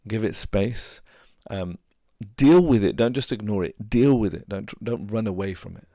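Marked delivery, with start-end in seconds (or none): 2.3-3.0 "deal" punched much louder and higher; stress on deal. Real, not acted. Advising a grieving listener, loud stress on "deal". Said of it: "advice emphasis". 3.9-4.5 "Deal" louder and higher, falling; stress on deal. Real, not acted. Repeating advice to a grieving listener, stressed "Deal". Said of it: "advice emphasis, repeated".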